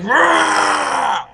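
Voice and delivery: high mock war cry; mock battle scream. Gandalf charging the orc army, hammed-up battle cry. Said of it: "screams as he's jumping".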